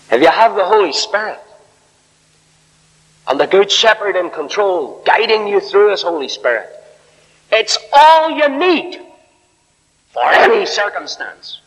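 high, ringing pulpit delivery; emphatic preaching. Real, not acted. Preacher assuring the congregation the Holy Spirit suffices, emphatic preaching. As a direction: drive the point home, stretching the climax.

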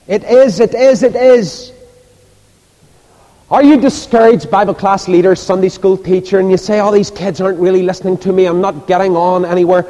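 high, insistent pulpit address; preaching. Real, not acted. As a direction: press the question on the congregation. Preaches at full force.

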